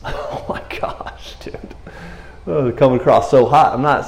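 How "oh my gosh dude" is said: whispered, slow, embarrassed aside; laughing whisper. Real, not acted. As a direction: breathe it out half-laughing. Embarrassed laugh at own words.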